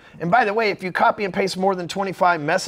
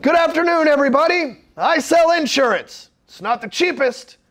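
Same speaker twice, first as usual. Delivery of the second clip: high, loud salesman addressing a room; hammed-up cheesy greeting to the crowd; loud room announcement; 6 semitones higher, 5 dB louder than usual. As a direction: announce it to the whole room like a huckster. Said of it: "walking into a room and being like".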